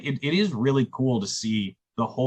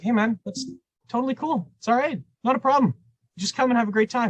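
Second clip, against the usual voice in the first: much higher, breezy reassurance; reassuring; 11 semitones higher, faster than usual. Retold or imitated. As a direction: pitch it up and wave his worry away. Reassuring the band by phone.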